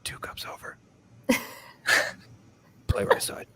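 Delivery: hushed whisper of a golf read. Retold or imitated. Caddy whispering putting reads behind the golfer, whispered. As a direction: murmur it under your breath like a secret tip. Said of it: "like, whisper".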